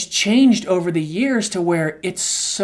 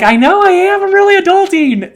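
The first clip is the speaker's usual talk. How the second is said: high, loud playful boast; mock proud squeal; 12 semitones higher, 8 dB louder than usual. Teasing his wife back, high and loud. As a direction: squeak it out like a kid showing off. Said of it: "And I'm like".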